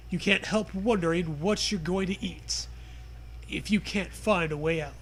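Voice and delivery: ordinary delivery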